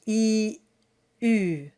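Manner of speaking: E, then lips round into U. Shown as a demonstration. Teacher shows how to get from E to the French U. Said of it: "round around that E sound".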